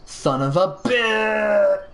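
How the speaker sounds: high, drawn-out cry of frustration; long anguished curse hurled at the sky; yelled to the heavens. Acted. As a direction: yell it skyward, stretching the last word long. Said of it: "I yell out to the, to the heavens".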